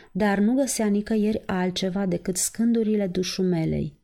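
ordinary delivery, nothing marked

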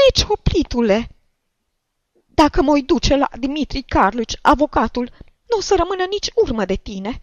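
put-on high, loud, outraged old woman; indignant tirade at the top of the voice; shrill screaming. A narrator's reading. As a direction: screech threats and complaints in a piercing voice. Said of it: "țipă deodată Șciuchina cu glas pițigăiat".

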